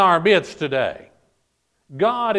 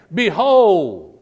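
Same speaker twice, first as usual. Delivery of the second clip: loud, drawn-out proclamation; emphatic cry to grab attention; 3 semitones higher, 7 dB louder than usual. Acted. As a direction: call it out like a great voice from heaven. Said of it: "cried out from heaven".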